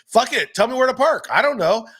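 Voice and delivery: comedian's husband snapping at his nagging wife; exasperated husband. Retold or imitated. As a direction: blow up like a henpecked husband. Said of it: "And he's like".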